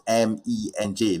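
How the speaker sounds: M E N J letter by letter; M E N J as em, ee, en, jay; spelled-out initials. Shown as a demonstration. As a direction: say each letter of M E N J by its name. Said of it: "it's actually supposed to be pronounced".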